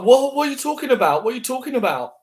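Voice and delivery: high mocking "what are you talking about"; mocking guy voice. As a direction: parody a creep playing dumb. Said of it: "they're like".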